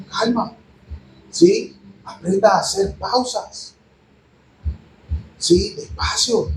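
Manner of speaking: hushed, with long gaps between phrases; soft calming. Real, not acted. Preacher urging young listeners to slow down, soft calming. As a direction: ease them down softly, pausing after each.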